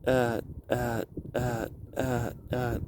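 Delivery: imitating a cave echo dying out; echo effect. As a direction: make the last word echo away. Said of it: "and it echoes".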